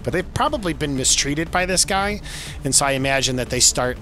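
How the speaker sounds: normal talking voice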